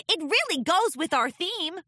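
put-on high young filly voice; proud little pony about her decorations; cheery filly. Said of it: "Sweetie Belle cheerily".

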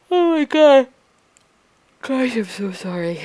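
talking through a real yawn; high, loud yawn easing into an apology. Real, not acted. Apologising to listeners late at night, yawning. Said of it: "forgive me for all the yawning".

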